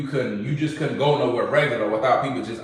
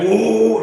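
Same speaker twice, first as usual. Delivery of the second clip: high, loud woo like the engine revving; engine roar imitation; 8 semitones higher, 6 dB louder than usual. Describing touching the gas on his Hellcat, a loud high engine woo. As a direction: fling out a big woo like flooring the gas. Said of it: "You touch the gas".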